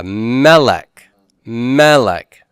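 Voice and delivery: melech drawn out with the stress up front, MEH-lech; stress on first syllable. Shown as a demonstration. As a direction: lean hard on the first syllable of melech, then fall. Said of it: "Double segul and first syllable stress".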